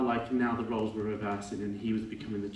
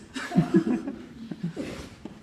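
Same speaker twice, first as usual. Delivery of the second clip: short real laugh; 14 semitones higher, 5 dB louder than usual. Real, not acted. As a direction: laugh at the memory. Laughs at father's dog line.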